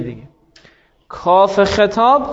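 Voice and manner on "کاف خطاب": each word drawn out; slow teaching emphasis; slow drill. Shown as a demonstration. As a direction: spell the term out slowly for the class. Teacher drills the term slowly.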